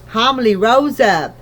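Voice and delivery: ordinary delivery, nothing marked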